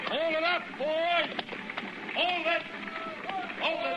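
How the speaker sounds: shouted order to halt the wagons; calling out. Acted. Wagon master halting train.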